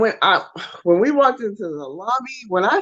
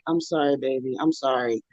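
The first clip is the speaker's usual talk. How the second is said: soft apology; 7 semitones lower, 8 dB quieter than usual. Retold or imitated. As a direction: lower and softer, gently sorry. Steve apologizing softly.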